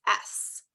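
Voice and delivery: voiceless hiss into the letter; S sound. Shown as a demonstration. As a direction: sound out "sss". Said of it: "do you hear the s sound".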